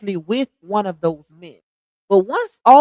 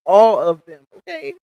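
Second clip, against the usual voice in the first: usual speaking voice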